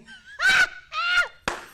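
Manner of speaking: shrieking laugh, way up high; helpless high cackle at the rival; high burst of laughter. Real, not acted. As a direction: crack up loud at the picture. Mocking rival's outfit, cracking up.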